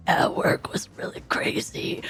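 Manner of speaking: voiceless worn-out whisper; barely any voice left; hoarse whisper. Retold or imitated. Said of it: "she'd be like".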